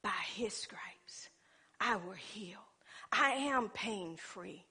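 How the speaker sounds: hushed, slow, low recitation; meditating. Retold or imitated. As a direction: murmur it slowly to yourself as a promise. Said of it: "I'm meditating".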